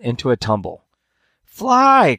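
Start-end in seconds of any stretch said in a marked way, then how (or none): 1.4-2.2 very high, loud, frantic shout; panicked cry. A narrator's reading. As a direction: scream it in rising panic. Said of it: "with far more anxiety than before".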